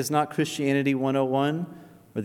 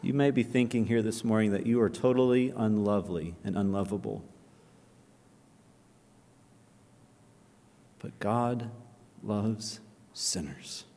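low and soft, sinking toward a whisper; tender appeal; 5 semitones lower, 7 dB quieter than usual. Acted. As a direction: plead gently, almost whispering.

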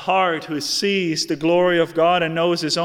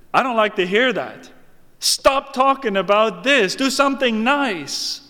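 raised, protesting voice; objecting listener; 7 semitones higher, 5 dB louder than usual. Acted. Listener objecting to a hard sermon, raised protest. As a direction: demand that the preacher stop.

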